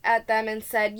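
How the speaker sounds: ordinary delivery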